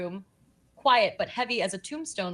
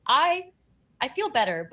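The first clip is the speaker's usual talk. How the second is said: gasping; 4 semitones higher, 6 dB louder than usual. Acted. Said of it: "Varen gasps".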